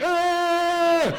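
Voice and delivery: sudden, high, loud panicked shriek; terrified scream. Retold or imitated. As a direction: scream in raw panic as the bug hits. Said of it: "I scream like a three hundred pound man".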